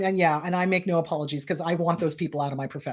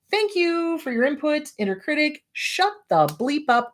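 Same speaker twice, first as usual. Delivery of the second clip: higher, sarcastic brush-off; dismissing the critic; 7 semitones higher than usual. Shown as a demonstration. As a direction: politely shut down the inner critic. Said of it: "then you put it down".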